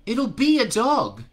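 put-on high, slow, sure kid's voice; confident boy. A narrator's reading. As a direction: declare it calmly like a boy. The master boy speaks.

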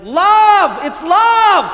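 full-voiced, high-pitched scream. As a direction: scream your head off with passion, holding the word long. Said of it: "I want to scream my head off".

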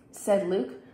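ordinary delivery, nothing marked

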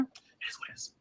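resigned whisper aside; whispered shrug. Real, not acted. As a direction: breathe it out, no voice.

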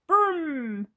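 high, loud rolled brum; high vrooming sound; toy car engine noise. Acted. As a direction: make a child's car noise.

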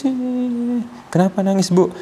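high, surprised question to the patient; lightly voiced doctor asking why she cries; imitating the doctor's question.

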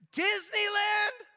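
exasperated comic punch; incredulous outburst. Real, not acted. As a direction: yell the word in mock despair. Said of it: "punchline outburst".

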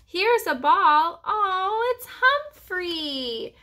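put-on high, slow, drawn-out little-girl excitement; delighted discovery. A narrator's reading. Little sister finds the lost hamster, delighted. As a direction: start loud, then coo the name up high.

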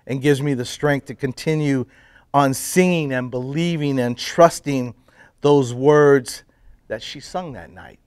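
ordinary talk, nothing marked

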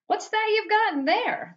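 high-pitched, insistent question; demanding. A narrator's reading. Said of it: "demanded to know".

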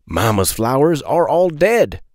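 ordinary delivery, nothing marked

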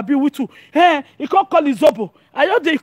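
normal talking voice

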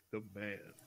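low hushed creepy storyteller; lightly spooky story read. Retold or imitated. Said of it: "He'll just read in a creepy voice".

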